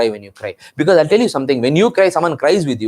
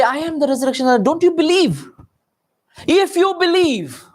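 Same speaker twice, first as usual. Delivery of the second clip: impassioned challenge; 13 semitones higher than usual. Acted. Preacher voicing Jesus to those who didn't believe, impassioned challenge. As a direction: press the acted challenge home, pitched high.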